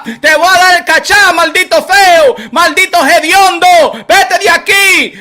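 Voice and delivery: high, loud announcer bellow; loud hype Spanish tirade at the hater; hype shout. Real, not acted. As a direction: shout the nicknames like a ring announcer. Hyping his show, chasing off hater.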